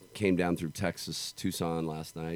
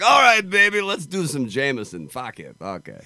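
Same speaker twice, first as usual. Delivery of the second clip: loud, high-pitched botched Irish accent, easing off at the end; hammed-up, all-wrong Irish accent; bad Irish accent; 9 semitones higher, 9 dB louder than usual. Shown as a demonstration. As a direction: start a terrible Irish accent big and high, then let it drop. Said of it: "give me an Irish accent".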